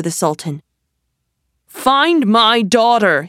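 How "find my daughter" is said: loud, slow, furious command; storming. A narrator's reading. Sultan to Aladdin over his lost daughter, storming. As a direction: thunder it out, each word leaned on. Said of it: "he stormed".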